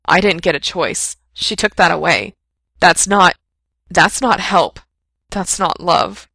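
loud, rising indignant outburst; bristling anger. A narrator's reading. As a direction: hurl it back at him, wounded. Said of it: "Frank felt himself bristle".

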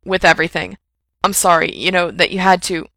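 loud, breathless rambling; babbling panic. A narrator's reading. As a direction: gabble on, unable to stop.

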